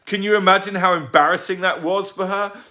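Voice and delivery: furious rant. Acted. Tugg ranting.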